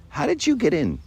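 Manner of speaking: high-pitched, baffled questioning; an angel baffled at who got in; puzzled angel. Acted. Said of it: "the angel says, you know".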